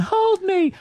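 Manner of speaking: very high mock-child plea; whining toddler. Toddler begging mother to hold him, high mock whine. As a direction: imitate a scared little kid. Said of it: "he's going".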